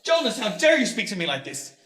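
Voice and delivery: enraged, coughing. Acted. Father shouting at son until he chokes, furious. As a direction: rage until the cough takes him.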